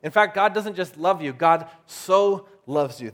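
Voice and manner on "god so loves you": "so" drawn out slow and loud; stress on SO. Shown as a demonstration. Preacher stressing God's love for the listener, emphatic stretched "so". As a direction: lean long and hard on "so". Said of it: "There's an emphasis in this verse".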